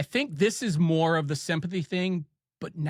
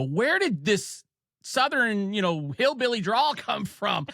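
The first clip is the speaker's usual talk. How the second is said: mocking kids; 6 semitones higher than usual. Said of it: "kids at school would literally make fun".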